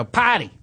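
"Party" with the R dropped, like "pah-dee". Shown as a demonstration. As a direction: say "Party" high, East Coast style without its R. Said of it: "You have to drop things".

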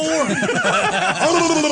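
long loud lip-and-tongue blubber; high, drawn-out blubbering burble; motorboating noise. Retold or imitated. Mocking the host, loud motorboating noise.

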